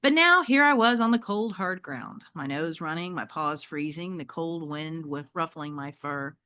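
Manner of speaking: usual speaking voice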